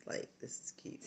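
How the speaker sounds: soft whispered aside to camera. Real, not acted. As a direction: breathe it out privately. Whispered aside while showing pumpkins.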